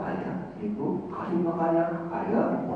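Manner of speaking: put-on a child murmuring thanks half asleep; sleepy child's whisper. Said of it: "Sampai jam dua". (anak berbisik mengantuk)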